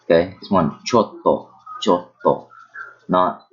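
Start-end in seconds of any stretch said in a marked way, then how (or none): 0.8-2.5 chotto with a held stop on the tt; pause inside word. Shown as a demonstration. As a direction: hold the tt of chotto for a beat. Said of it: "with that pause".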